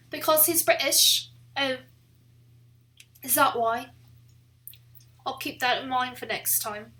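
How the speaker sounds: put-on American putting on a British accent; playful British accent by an American; attempted British accent. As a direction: slip into an amateur British accent. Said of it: "my American attempt at a British accent".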